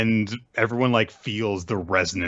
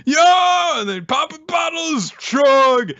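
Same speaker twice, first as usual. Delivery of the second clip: loud, high hyped-up party bro yell; 6 dB louder, slower than usual. Retold or imitated. A cohost imitating the party bro hyping the crowd, in a high loud yell. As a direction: shout it like a frat guy at a kegger. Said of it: "he's every party bro too".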